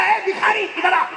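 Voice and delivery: calling out. Retold or imitated. Preacher hailing a rich man's son in torn jeans, calling out. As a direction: holler it as a teasing summons. Preacher calls out to ragged youth.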